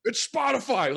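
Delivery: high, loud shout of recognition; the crowd points and shouts at the fake Spotify CEO; imitated crowd shout. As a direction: yell it like a fan spotting a celebrity. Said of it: "people are pointing out".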